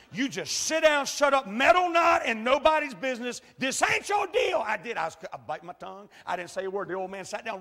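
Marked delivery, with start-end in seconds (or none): 0.1-4.6 self-scolding shout. Retold or imitated. Preacher scolding himself inwardly.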